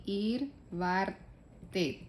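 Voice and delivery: very slow, careful German; slow German demo. Shown as a demonstration. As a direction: wartet drawn out syllable by syllable. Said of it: "Entonces aquí". (pronunciación alemana muy lenta)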